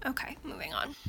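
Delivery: breathy whispered aside. Real, not acted. Moving on in her demo, she whispers the aside. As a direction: mutter it to yourself under your breath.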